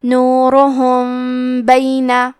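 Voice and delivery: نورہم بینہ with the م hidden in a humming nasal; nasal ghunna. Shown as a demonstration. Teacher demonstrates ikhfa with a held nasal hum. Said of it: "میم ساکن کو چھپاتے ہوئے اور غنہ کے ساتھ".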